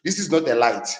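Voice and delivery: ordinary talk, nothing marked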